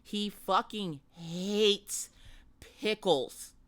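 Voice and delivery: emphatic slowed repeat. Shown as a demonstration. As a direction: spell it out slowly for the back row. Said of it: "let me repeat that for those folks in the back".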